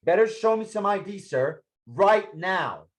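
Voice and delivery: high, pushed order for ID; hard demand. Acted. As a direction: make him obey now. Cop demanding ID.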